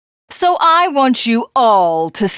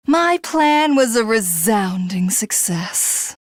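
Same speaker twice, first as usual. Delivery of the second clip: ordinary talk, nothing marked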